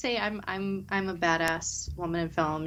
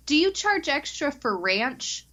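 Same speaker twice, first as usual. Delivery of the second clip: higher, slow, careful question; retold drive-thru order; 8 semitones higher, slower than usual. Guest re-enacts asking Burger King about ranch, carefully enunciated.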